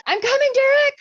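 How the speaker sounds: high-pitched, loud battle call; hammed-up rescue shout; mock heroic call. Acting out a called-out movie line.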